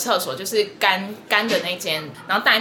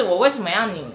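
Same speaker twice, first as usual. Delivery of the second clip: ordinary delivery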